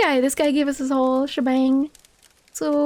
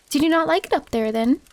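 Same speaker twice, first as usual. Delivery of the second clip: ordinary talk, nothing marked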